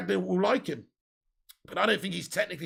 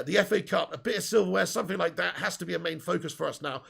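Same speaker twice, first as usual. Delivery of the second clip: ordinary talk, nothing marked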